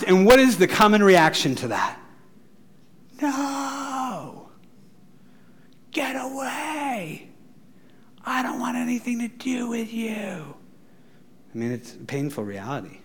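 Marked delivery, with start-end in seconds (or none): 3.2-10.5 higher and softer, slow on get away; a hurt kid pushing you away; rejecting teen. Retold or imitated. A teenager turning away from the pushy church, higher and soft.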